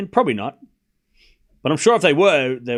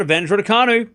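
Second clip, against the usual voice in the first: raised, louder announcement of advantage; excited score call; 7 semitones higher, 5 dB louder than usual. Real, not acted. Commentator calls advantage to Raducanu after the return goes out, excited. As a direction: lift the score call high and strong. Live call of the point won.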